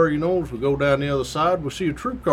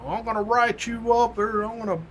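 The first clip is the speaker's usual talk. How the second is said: sergeant ripping them; 8 semitones higher than usual. Retold or imitated. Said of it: "he's just ripping them".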